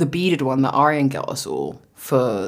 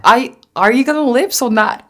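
high, loud mock American accent; a hammed American accent; 9 semitones higher, 5 dB louder than usual. Imitating friend's American accent, loud and high. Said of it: "in a little American accent".

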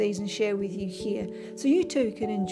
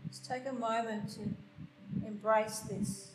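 guided meditation; 8 dB quieter than usual. Acted. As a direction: guide them gently into stillness. Leads a meditation.

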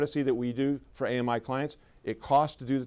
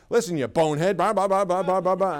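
loud, higher mock rant at the caller; imitating a phone rant; 5 semitones higher, 5 dB louder than usual. Employee telling off a caller on the phone, mock angry rant. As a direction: bark it down the phone like a rude clerk. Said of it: "she's on the phone and she's going".

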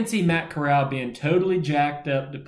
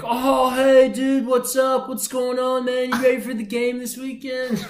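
surfer dude voice; 9 semitones higher than usual. Retold or imitated. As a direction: do your buddy as a surfer. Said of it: "he kinda sounds like a surfer".

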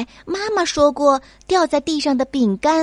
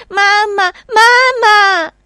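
high, loud frightened cry of mama; a scared kid yelling for help; calling for mom; 10 semitones higher, 9 dB louder, slower than usual. Acted. Child calls mother in fear. (孩子惊恐地高声喊妈妈)

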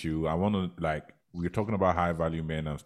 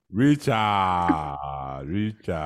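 calling out; 4 semitones higher, 5 dB louder, much slower than usual. Real, not acted. Calling out to friend.

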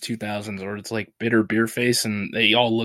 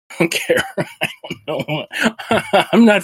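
breaking up into laughter, higher pitched; laughing; 7 semitones higher, 4 dB louder than usual. Real, not acted. Host amused at own fake accent school, laughing helplessly. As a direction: giggle through the words.

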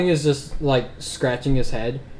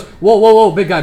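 higher, louder whoa of alarm; Hope, alarmed; 6 semitones higher, 9 dB louder than usual. Acted. Hope calling out to a bleeding friend in louder, higher alarm. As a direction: rush in to stop a hurt friend. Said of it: "Hope just goes".

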